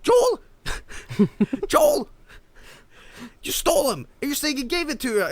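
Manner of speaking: high-pitched scolding mom voice; imitating an exasperated mom; righteously pissed mother. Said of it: "She was righteously pissed".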